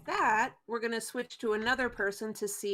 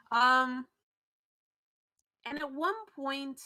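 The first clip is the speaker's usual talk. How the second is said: normal talking voice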